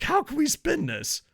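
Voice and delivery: high mock panicked question; hammed-up high voice of the group; mock scheming group. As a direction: ask it like a worried spin doctor.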